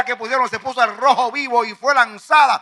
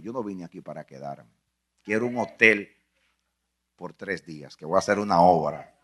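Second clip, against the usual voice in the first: hushed sermon storytelling; 8 semitones lower than usual. Acted. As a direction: draw them in with a low hushed telling. Hushed retelling of the tomb.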